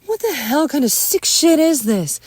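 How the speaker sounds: high, loud angry demand; shouted, appalled question; furious outrage. Acted. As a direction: yell it in disgust and shock. Outraged after trying glasses.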